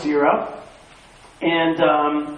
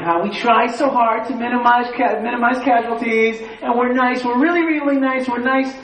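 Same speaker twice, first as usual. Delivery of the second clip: higher, insistent mock pleading; self-mocking, repetitive high protest; 5 semitones higher than usual. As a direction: parody an over-earnest plea in a raised pitch.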